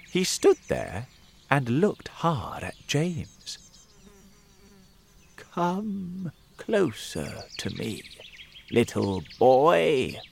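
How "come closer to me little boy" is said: put-on slow old man voice. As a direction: draw it out like a sly old stranger. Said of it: "he said in a slow voice".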